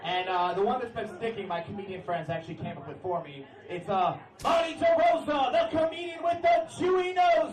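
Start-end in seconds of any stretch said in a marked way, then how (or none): 4.4-7.5 announcer shout. Pretend. Comic reveals the nickname friends gave him, hype shout. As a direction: bellow it to the crowd.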